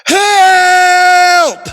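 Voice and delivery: loud high desperate yell; cry for help. Acted. Oba hanging from a branch, yelling loud and high. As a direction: shout up the mountain for rescue. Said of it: "And then he says".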